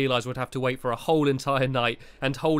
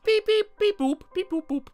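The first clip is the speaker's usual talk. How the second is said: high, silly robotic beeps; alien beeping. Acted. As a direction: beep like a goofy alien. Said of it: "pretending to be an alien".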